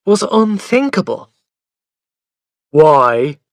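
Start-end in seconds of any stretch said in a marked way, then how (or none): 2.7-3.4 a sulky, much louder why; a put-out child demanding a reason; cross. A narrator's reading. Boy challenging his mother's order, cross and loud. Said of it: "I asked crossly".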